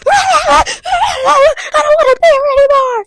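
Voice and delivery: loud, high wailing reenactment; exaggerated bawling yell; mock scream crying. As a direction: scream it through hammed-up sobs. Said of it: "I'm just over here scream crying".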